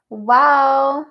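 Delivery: amazed, loud exclamation; sudden loud wow of amazement; loud surprised wow. Real, not acted. Reacting to nine years.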